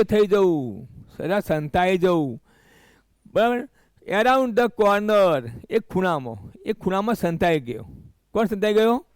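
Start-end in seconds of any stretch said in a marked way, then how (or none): 4.1-5.5 slow reading aloud. Shown as a demonstration. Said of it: "teacher reads phrase slowly".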